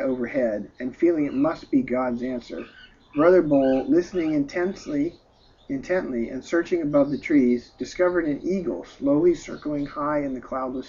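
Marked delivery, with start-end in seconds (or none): none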